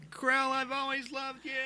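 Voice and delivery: high, soft mock declaration; mock farewell. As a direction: swoon it at your friend as you're hauled off. Dragged off, calling to friend.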